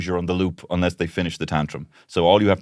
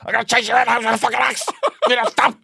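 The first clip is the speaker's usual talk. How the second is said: hammed-up shrill threat, fast, much higher; mum's mock threat; 3 dB louder, faster than usual. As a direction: rattle it off like an exasperated mum chasing you. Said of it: "my mom once said".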